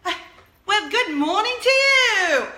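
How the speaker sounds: loud, high, drawn-out fake British reply; British accent. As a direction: sing out a hammed-up British greeting. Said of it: "I actually started speaking British to him".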